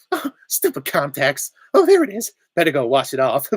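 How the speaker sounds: high, loud cartoon voice; SpongeBob. Cartoon sponge fussing over contacts, high loud impression. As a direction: act it out with squeaky leaps upward. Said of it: "And SpongeBob's like".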